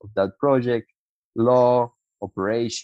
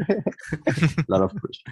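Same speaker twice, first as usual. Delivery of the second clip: laughing; 7 semitones higher, slower than usual. Real, not acted. Laughs at the big introduction.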